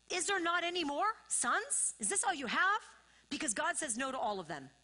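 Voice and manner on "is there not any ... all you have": higher, louder acted-out question; insistent questioning. Preacher voices Samuel asking about more sons, insistent questioning. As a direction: press the question as Samuel would.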